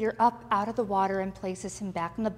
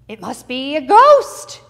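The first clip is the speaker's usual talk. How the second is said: loud, panicked guessing in a raised voice; frightened disciples; 4 semitones higher, 10 dB louder than usual. Acted. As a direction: blurt it out in fear, voice climbing.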